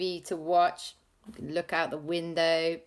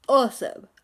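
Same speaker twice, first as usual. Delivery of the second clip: usual speaking voice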